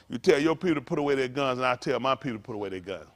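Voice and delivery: fast, low, flat quote of Malcolm; quick, low mock Malcolm speech; imitating Malcolm X. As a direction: rattle it off like Malcolm laying down terms. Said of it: "Tell you like Malcolm told".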